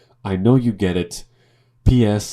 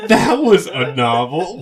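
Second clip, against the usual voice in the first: slow, drawn-out snooty reader's verdict; mock a pompous critic savouring the line; pretentious book reader; 7 semitones higher, 7 dB louder, slower than usual. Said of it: "A pretentious book reader that is like".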